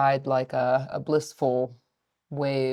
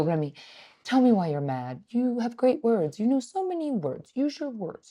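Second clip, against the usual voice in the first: gentle technique; 6 semitones higher, 4 dB quieter than usual. Retold or imitated. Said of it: "I start with the gentle technique".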